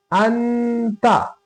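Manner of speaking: अंता slowly with the नून hummed in the nose; nasal ghunna. Shown as a demonstration. Teacher shows ghunna on the word, slow and nasal. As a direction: stretch अंता with a long nasal न. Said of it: "ता आ गया, इसलिए".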